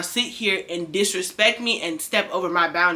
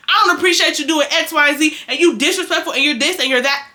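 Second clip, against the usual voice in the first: louder, higher accusing outburst; confrontational; 6 semitones higher, 4 dB louder than usual. Shown as a demonstration. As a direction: come at them hot, picking a fight. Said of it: "hey, tempers will flare".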